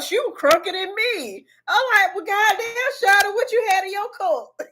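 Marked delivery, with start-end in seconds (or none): 2.1-4.6 high, laughing mock outrage; laughing shock. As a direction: squeal it through a laugh. Retelling her shocked reply to nurse.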